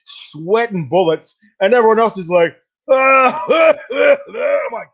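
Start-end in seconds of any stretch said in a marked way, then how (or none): 2.9-4.2 high, loud repeated "ah"; mock panicked wailing; imitated choking cries. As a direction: mimic a room full of people reeling. Imitates everyone reacting to the spray.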